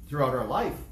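ordinary delivery

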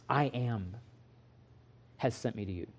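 very soft, low and hushed; solemn divine voice. Retold or imitated. God naming himself to Moses, hushed and low. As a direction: speak it like a sacred whisper. God's words.

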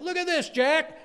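put-on high, loud boy showing off; little boy. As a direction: call out excitedly like a small boy. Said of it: "little boy went".

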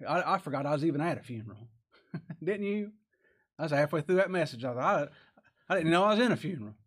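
preacher joking to the congregation, fast and loud; excited joking. Real, not acted. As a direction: rush it out like a funny confession. Joking about funeral message.

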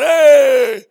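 loud, high, joyful "Hey!"; excited shout. Retold or imitated. Italian neighbor greeting Max with a loud, happy shout. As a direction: burst out greeting a countryman.

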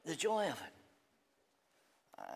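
very slow, lingering and soft; savouring joy. Acted. Preacher savouring eternal joy for his friends, slow and soft.

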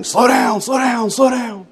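high, repeated shouted warning; retold hollering. Young man at the bottom hollering at the pastor to slow down. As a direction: yell it down the hill at a sledder. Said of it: "he's hollering at me".

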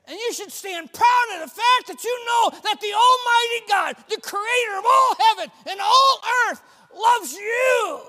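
high, loud and slow, rousing the church; call and response preaching. Real, not acted. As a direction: whip the congregation up.

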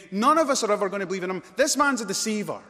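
loud, high-pitched outraged denunciation; furious Pharisees. Acted. Pharisees reject Jesus before their officers, furious shouting. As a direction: shout the accusation in fury. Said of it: "the fury here of the".

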